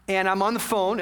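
ordinary delivery

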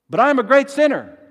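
loud, high-pitched objection; anguished protest, pushed high and loud; fearful sinner's protest. Acted. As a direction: cry out as a sinner afraid of rejection. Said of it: "the fears of the saints".

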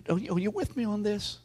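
ordinary talk

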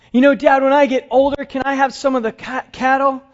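put-on a boy pitching his dream to Dad; eager boy.

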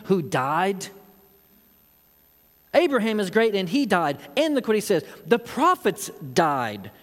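an affronted crowd demanding answers; heated challenge. Acted. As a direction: hurl the questions back at him.

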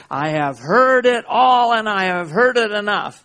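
loud, high-pitched acted scream; Satan screaming. Tempter in the listener's ear, screaming. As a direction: shout it as if right into an ear. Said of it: "Satan's screaming in your ear".

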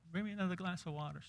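put-on high, soft and quick child's request; a little girl pestering from bed; little girl asking. Little girl in bed asks mama for water, high and soft.

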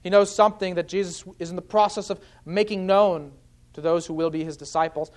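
normal talking voice

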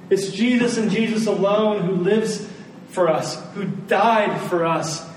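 ordinary delivery

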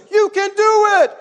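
put-on very high child's cheer; imitating cheering daughter. Daughter calls up to her frightened dad, cheering. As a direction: urge Dad on in a squeaky-high kid voice.

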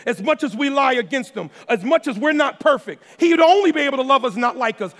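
high, loud preaching at full force; building sermon delivery, loud and high; fiery preaching. Acted. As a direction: preach it out to the congregation, hitting every word.

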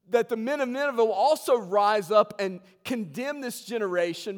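loud, high-pitched pulpit delivery; full-force preaching. Real, not acted. Preacher telling congregation about Nineveh and Jonah, full-force preaching.

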